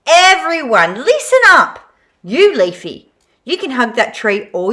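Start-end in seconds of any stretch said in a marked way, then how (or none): 0.1-1.8 higher, louder call to the crowd; rallying call. A narrator's reading. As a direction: command attention from all. Forky addresses crowd.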